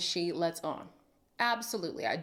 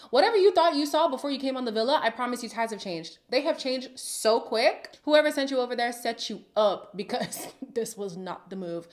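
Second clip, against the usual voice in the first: ordinary talk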